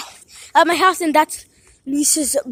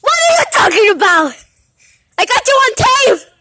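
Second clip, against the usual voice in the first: shouting; 7 semitones higher, 4 dB louder than usual. Real, not acted. Kids calling out outdoors.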